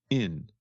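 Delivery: "in" with the G dropped; ING shortened to "in". Shown as a demonstration. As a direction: say a short "in", ending on N. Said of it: "gets shortened to just the sound".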